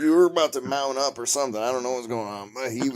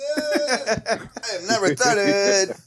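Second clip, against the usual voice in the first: higher, slow dopey voice; mock dim voice; 11 semitones higher, much slower than usual. Mocking a friend who said it, higher and slow.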